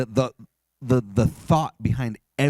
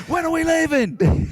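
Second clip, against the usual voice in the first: high, loud impatient shout; annoyed yell; 5 dB louder than usual. Retold or imitated. Imitating actor yelling at Harrison on set, annoyed shout. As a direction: holler it like a fed-up co-star. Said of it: "how annoyed Peter sounds when he's yelling at Harrison".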